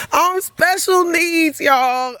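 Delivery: crying. Real, not acted. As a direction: voice breaking high into tears. Overcome while telling the hug.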